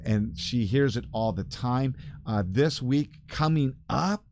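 ordinary talk

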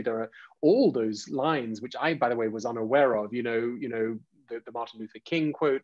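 ordinary talk, nothing marked

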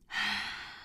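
long breathy exhale; big breath out sigh. Shown as a demonstration. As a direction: breathe out slowly. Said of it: "take our big breaths".

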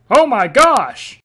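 loud, slow, shocked cry; shocked shout. Acted. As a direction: draw out the shock loudly. Shocked outburst.

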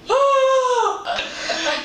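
high theatrical gasp into laughter; dramatic gasp. Pretend. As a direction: gasp loudly, then laugh.

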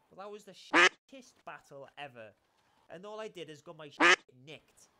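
loud, low grumbling complaint; frustrated rant. Real, not acted. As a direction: vent about the lost battle. Loses battle badly.

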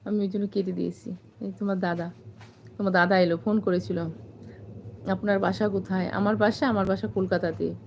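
ordinary talk, nothing marked

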